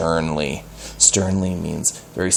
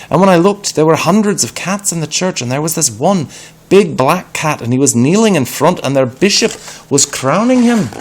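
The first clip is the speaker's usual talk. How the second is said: higher, louder, fast breathless telling; excited boy; 9 semitones higher, 6 dB louder than usual. Acted. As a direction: blurt it out in a rush. Peter excited report continues.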